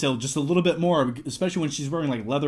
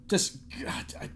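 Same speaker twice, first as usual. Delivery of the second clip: awed whisper; 9 dB quieter than usual. Real, not acted. Overcome while holding Point Break.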